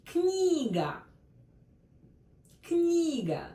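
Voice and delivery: книга said slowly with stress on the first syllable, кни; teacher models книга with stress on кни; clear model word. Shown as a demonstration. Teacher models the word for learners, clear and careful. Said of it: "This is very important word".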